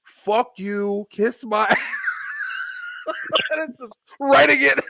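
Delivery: shouting through laughter; can't stop laughing while dictating; real laughter breaking through shouted insults. Real, not acted.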